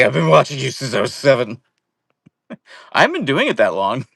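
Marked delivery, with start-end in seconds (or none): 0.0-1.6 higher, louder mock creepy-fan voice. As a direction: play the unsettling lifelong fan for laughs. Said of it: "You're like".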